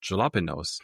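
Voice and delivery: jalapenos with a hard English J, "juh-lah-puh-nose"; pronounced with J. Retold or imitated. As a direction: say jalapenos just as it is spelt. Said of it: "How is a J from the jalapenos".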